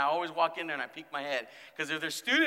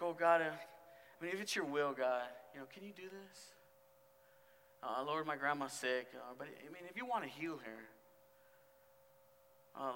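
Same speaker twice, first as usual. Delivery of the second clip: soft, hesitant mumbled prayer full of hedges; a mock-timid little prayer, trailing off; 10 dB quieter than usual. Shown as a demonstration. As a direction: pray meekly, afraid to ask for much. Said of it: "We need to go with boldness".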